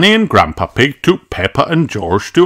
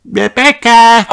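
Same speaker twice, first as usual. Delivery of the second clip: much higher, louder call of Rebecca; little girl calling her friend's name; 6 dB louder than usual. A narrator's reading. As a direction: call across the market to a friend. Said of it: "cried Peppa".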